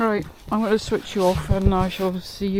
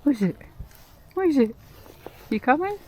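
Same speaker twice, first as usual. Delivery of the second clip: calling to a dog; 7 semitones higher, faster than usual. Real, not acted. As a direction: call the dog over, bright and eager.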